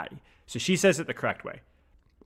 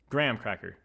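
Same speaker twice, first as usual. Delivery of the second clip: ordinary delivery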